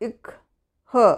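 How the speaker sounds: ഇക് then ഹ, each letter sound isolated. Shown as a demonstration. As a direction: break it into ഇക് and ഹ slowly. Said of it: "എച്ചിൻ്റെ pronunciation ഹ".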